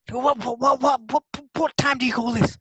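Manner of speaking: high, exasperated what what what; mock outrage at a late friend; stammering indignant high voice; angry demand. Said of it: "there was me thinking".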